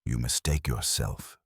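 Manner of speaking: soft and low, quietly cutting; softly. A narrator's reading. Wolf softly correcting the defeated Sabin, low and quiet. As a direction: let it drop soft and low, almost to himself. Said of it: "Wolf said softly".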